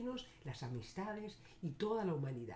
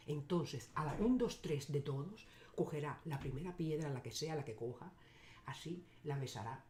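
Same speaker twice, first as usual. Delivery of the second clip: ordinary talk, nothing marked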